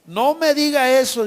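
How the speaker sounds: loud, high-pitched mock dismay; alarmed protest. Seller hearing the buyer cancel the deal, acted alarmed protest.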